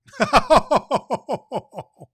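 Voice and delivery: big, high, loud belly laugh; jolly laugh. Real, not acted. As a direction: let a hearty Santa laugh roll out. Santa laughs at the letter.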